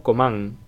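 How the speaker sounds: "comment" said bluntly with a heavy nasal ending, like "koman"; exaggerated French nasal. Shown as a demonstration. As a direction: overdo the nasal on "comment", spelled out crudely. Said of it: "Dicho a lo bruto".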